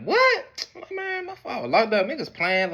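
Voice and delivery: very high shocked what; disbelief. Retold or imitated. Son thinks friends are pranking him at the door. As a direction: refuse to believe it.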